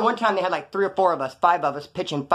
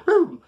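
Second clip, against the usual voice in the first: high, loud imitated burst; sudden boom; 13 semitones higher, 5 dB louder than usual. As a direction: punch out a sudden sound effect. Imitates police lights suddenly coming on.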